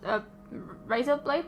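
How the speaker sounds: ordinary talk, nothing marked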